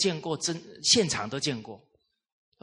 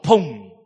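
imitated thud; 12 dB louder than usual. Child falls hard in story.

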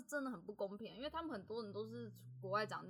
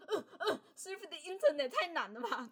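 high, loud, laughing stammer over surf the internet; flustered schoolgirl; 8 semitones higher, 8 dB louder than usual. Retold or imitated. Retelling being called on in class, mock flustered stammer. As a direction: replay the panic of being called on. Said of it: "我就很害怕".

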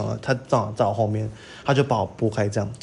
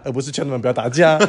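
ordinary delivery

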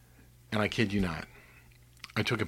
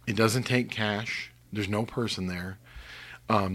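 ordinary delivery

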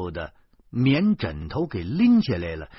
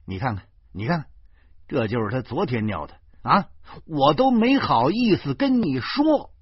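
quick accusing start, building louder; indignant anger; 4 semitones higher, 5 dB louder than usual. Acted. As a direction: air a grievance with rising fury. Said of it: "我怒不可遏".

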